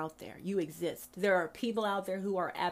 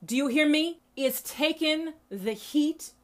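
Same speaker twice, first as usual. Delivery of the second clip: quoting mama; 5 semitones higher, 5 dB louder, slower than usual. Retold or imitated. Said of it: "She said".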